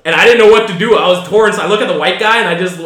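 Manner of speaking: ordinary delivery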